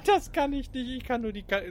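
normal talking voice

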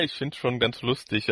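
ordinary delivery

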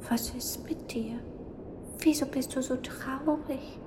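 put-on a kind spirit whispering to a sad child; gentle little ghost. Ghost comforting the crying witch in a high soft whisper. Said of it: "schuhute die kleine nebelige Gestalt".

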